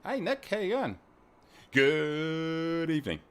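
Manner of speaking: long drawn-out, loud, high hello; showy, elongated greeting; hammed-up greeting. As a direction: stretch GOOD right out, loud and high.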